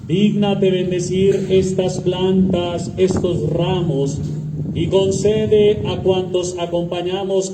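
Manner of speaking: solemn measured prayer voice; liturgical prayer. Acted. Priest leads opening prayer.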